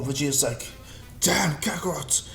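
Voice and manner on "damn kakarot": dismayed rival, higher put-on voice; Vegeta. Rival watches Goku power up, acted dismay. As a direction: act out a rival's dismay, pitched up.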